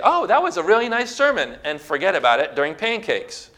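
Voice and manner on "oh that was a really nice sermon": high, lilting mock compliment; breezy higher-pitched imitation of a churchgoer; casual churchgoer. Churchgoer politely praising the sermon after service, high-pitched mock compliment. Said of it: "You can't just say".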